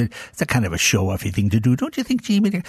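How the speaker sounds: lightly voiced disapproving mom; mother mocking. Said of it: "And my mother".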